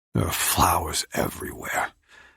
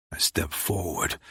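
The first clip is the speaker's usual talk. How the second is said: ordinary delivery, nothing marked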